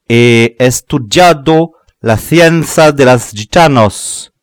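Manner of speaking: slow, loud, strange proclaiming voice; sorcerer's boast. A narrator's reading. Sorcerer boasts to the crowd, slow and loud. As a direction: intone it like a mystic.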